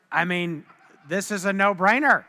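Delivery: ordinary talk, nothing marked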